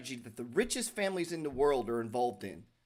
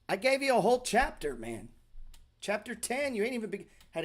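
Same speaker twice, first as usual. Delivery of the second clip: exasperated outburst; 6 semitones higher than usual. Real, not acted. Exasperated at readers ignoring chapter.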